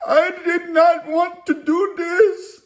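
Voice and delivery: high, slow, tearful imitation; mock tearful whine. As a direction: plead it out like a hurt man near tears. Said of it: "He's like".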